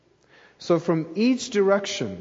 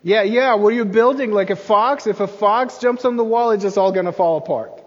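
louder, higher jeering yes man; eager yes man; 5 semitones higher, 6 dB louder than usual. Retold or imitated. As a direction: pile on with a gleeful sneer. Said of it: "He's sort of the yes man here".

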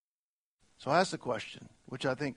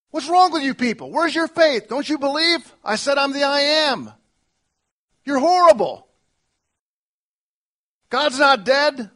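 loud, high scolding shout; mock angry rebuke; 11 semitones higher, 13 dB louder than usual. Preacher voicing an imagined God scolding his doubting people, loud and harsh. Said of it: "and rebuke them".

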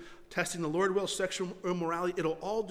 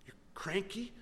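whispered reproach; hushed whisper of conscience; 7 dB quieter than usual. Retold or imitated. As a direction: breathe it out softly. Lord's voice, whispered.

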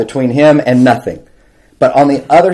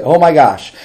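normal talking voice

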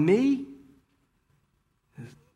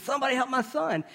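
frantic mother; 10 semitones higher, faster than usual. Retold or imitated. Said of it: "and she says".

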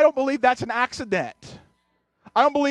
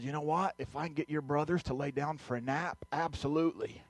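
put-on much softer, easy yes to the kids; soft fatherly reply; 5 semitones lower, 12 dB quieter than usual. Dad gently answering his daughters, much softer. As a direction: hush it like kids might be napping.

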